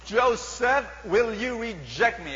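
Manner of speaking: loud, high, slowed indignant demand; hammed-up outrage at being refused; offended mistress. Potiphar's wife confronting Joseph, loud and indignant. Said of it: "acted Potiphar's wife".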